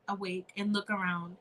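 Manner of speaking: ordinary delivery, nothing marked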